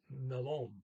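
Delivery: "melon" with a short vowel before the L; short first vowel. Shown as a demonstration. Teacher explaining double L to learners, short preceding vowel. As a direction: keep the first vowel of "melon" short, second syllable stressed. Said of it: "vowel short".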